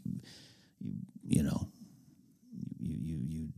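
whisper. Real, not acted. Trails into a hushed aside.

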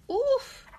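high startled oof. Acted. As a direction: react to the slam. Door slammed.

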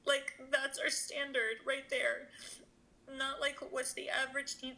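high voice, choked with real tears; tearful. Real, not acted. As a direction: make an earnest plea while crying. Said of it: "I'm sorry for crying".

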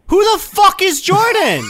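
furious shout down the phone; angry yell. Acted. Prank call, fake angry guy.

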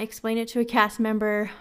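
normal talking voice

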